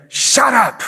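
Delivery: high, forceful command to be silent; shushing someone. Acted. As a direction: shut them down in sharp bursts. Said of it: "Who in your life are you saying".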